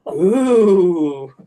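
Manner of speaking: drawn-out eerie ghostly call; a spooky "ooh", swooping up and down; creepy voice. Acted. Imagining a voice heard while falling asleep, creepy. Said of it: "some creepy voice like".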